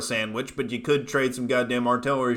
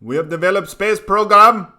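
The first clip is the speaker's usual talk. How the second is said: higher, louder mock African accent, broken English; bad African accent; 6 semitones higher, 9 dB louder than usual. Streamer mocking Uganda's space program in a bad African accent. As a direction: ham up a crude African accent. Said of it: "Worst African accent ever".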